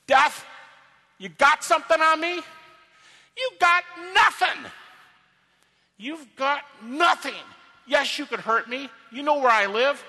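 overdone, high shouted challenge; loud taunt. Shown as a demonstration. Paul taunting death on behalf of Jesus Christ, loud and defiant. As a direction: taunt hard, punching NOTHING. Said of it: "he's taunting death".

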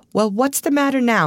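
mildly impatient, starting high and dropping; a little impatient director; touch of impatience. A narrator's reading. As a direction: ask with slight exasperation at the hold-up. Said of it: "with a touch of impatience".

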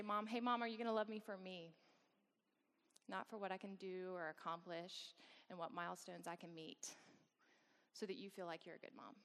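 soft, tender plea that fades out; tender child voice. Retold or imitated. Baby son asking his mom for her love, softly. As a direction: ask gently for unconditional love. Baby's eyes speaking to mother.

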